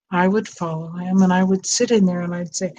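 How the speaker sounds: ordinary talk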